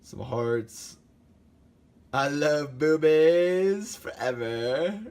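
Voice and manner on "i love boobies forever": sing-song. Shown as a demonstration. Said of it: "That's my favorite one".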